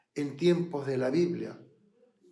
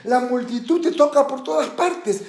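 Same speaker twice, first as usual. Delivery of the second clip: high, loud, incredulous protest; Pedro; 9 semitones higher, 8 dB louder than usual. Acted. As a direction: protest to the master, voice raised. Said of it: "Y Pedro le dice". (protesta aguda e incrédula)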